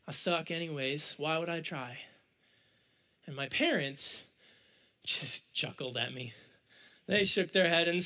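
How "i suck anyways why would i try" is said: low, soft, defeated self-talk; ashamed self-pity. Retold or imitated. Teenager giving up after the cast list, quietly dejected. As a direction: act out giving up on yourself. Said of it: "I started to be shameful".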